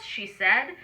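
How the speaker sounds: usual speaking voice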